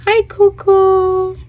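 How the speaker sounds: high, slow sing-song coax to a bird; high coaxing pet voice. Real, not acted. Owner coaxing her bird Coco to say hi, high and coaxing.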